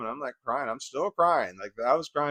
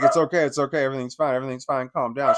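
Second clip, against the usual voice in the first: plain reading voice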